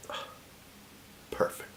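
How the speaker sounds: whispered, savoring approval; retold priest tasting the drink, soft and satisfied. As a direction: breathe it out like a connoisseur.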